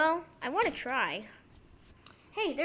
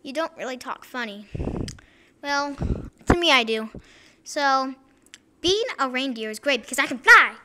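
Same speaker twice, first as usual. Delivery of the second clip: usual speaking voice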